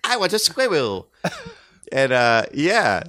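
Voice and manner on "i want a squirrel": lightly pitched-up mock British brat; Veruca Salt. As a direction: imitate a demanding little British girl. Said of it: "Veruca, darling".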